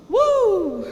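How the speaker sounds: high, loud rock woo; high woo from the song. Retold or imitated. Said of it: "that song says".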